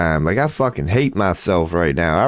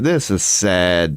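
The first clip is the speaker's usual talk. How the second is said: normal talking voice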